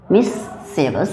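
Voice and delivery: careful, hushed teacher's mischievous, falling at the end; trouble-spot demonstration. Shown as a demonstration. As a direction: draw out mischievous in separated syllables. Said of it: "कहाँ पे गड़बड़ आपका मिल रहा होगा".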